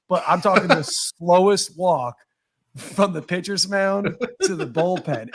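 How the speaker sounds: ordinary delivery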